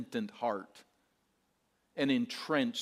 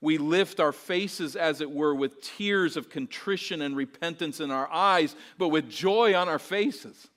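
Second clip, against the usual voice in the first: rising fervor; 4 semitones higher, 7 dB louder than usual. Real, not acted. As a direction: lift the congregation with joy. Sermon builds to joyful climax.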